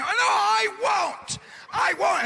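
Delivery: high, dragged-out whining shout; child refusing his mother, wailing; whiny kid shout. Retold or imitated. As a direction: wail it like a defiant child. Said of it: "kids talk back".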